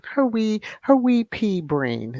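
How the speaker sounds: half-hearted Scottish lilt; mock Scottish, not quite hitting it; attempted Scottish accent. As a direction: try a light Scottish accent. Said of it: "like, a Scottish accent".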